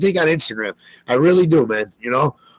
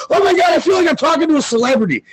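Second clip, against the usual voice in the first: starstruck fan; 10 semitones higher, faster than usual. Retold or imitated.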